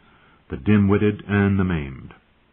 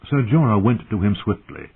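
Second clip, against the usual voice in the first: ordinary delivery, nothing marked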